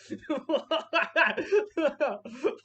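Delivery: loud, high burst of derisive laughter; mocking laughter. Real, not acted. Commentator jeering at a streamer's breakdown, loud mocking laugh. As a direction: laugh loudly at his meltdown. Mocks the streamer after the clip.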